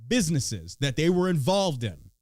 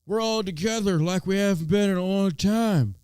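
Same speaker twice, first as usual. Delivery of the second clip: louder, put-on folksy Biden voice; mock Biden; 2 semitones higher, 3 dB louder, slower than usual. Mocking Biden's State of the Union unity line, lightly louder put-on voice. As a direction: parody a feel-good presidential speech. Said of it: "He's like".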